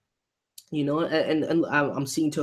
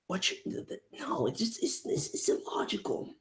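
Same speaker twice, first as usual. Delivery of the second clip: stunned mutter; 3 semitones higher, 10 dB quieter than usual. Real, not acted. Reactor sputtering in disbelief.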